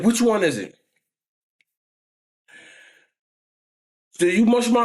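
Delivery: confronting aunt. Retold or imitated. Aunt confronting the boy downstairs, high and demanding. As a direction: call out the bully like an angry aunt.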